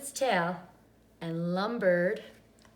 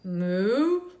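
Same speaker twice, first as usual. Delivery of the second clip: cow moo; 5 dB louder than usual. A narrator's reading. Cow moos.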